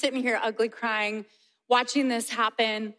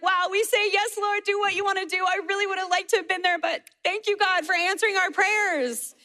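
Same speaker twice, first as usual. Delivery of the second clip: high, animated prayer of thanks; exclaimed thanks; 6 semitones higher than usual. Acted. Mother thanking God despite missing the baptism, exclaimed thanks. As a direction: pitch it up in acted amazement. Acting out parents' amazed grateful prayer.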